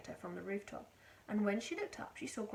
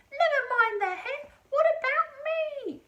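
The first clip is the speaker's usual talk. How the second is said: Grandma shouting; 13 dB louder than usual. A narrator's reading. Grandma shouting from the rooftop for attention. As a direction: demand attention from the roof.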